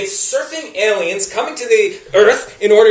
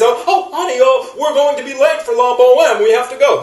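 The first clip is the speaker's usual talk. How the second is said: higher mock-pompous husband voice; intellectual new husband; 3 semitones higher, 4 dB louder than usual. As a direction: play the jerk husband fussing about the opera. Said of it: "the new husband says".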